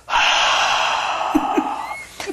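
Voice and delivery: a big theatrical sigh during the sermon; huge exaggerated exhale, meant to be heard; loud stage sigh. Man mocking the priest's sermon with a loud stage sigh. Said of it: "the loudest stage sigh".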